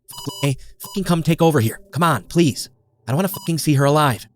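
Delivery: normal talking voice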